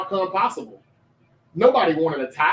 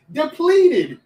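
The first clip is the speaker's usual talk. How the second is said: higher and louder, mocking the word; scoffing emphasis; 7 semitones higher, 5 dB louder than usual. Real, not acted. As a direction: throw the word back with scorn. Scoffing repeat of rival's excuse.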